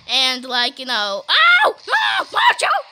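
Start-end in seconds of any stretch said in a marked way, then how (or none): 1.3-2.8 high, loud yelps of alarm; alarmed yell. Real, not acted. A player yelping as her character gets hit, high alarmed yell. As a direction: yell a warning.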